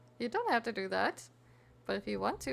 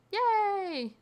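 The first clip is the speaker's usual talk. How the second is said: high, louder little cheer; playful high cheer for a chatter off to work; cheerful yay; 12 semitones higher, 6 dB louder than usual. Real, not acted. Cheering mock excitement for chatter.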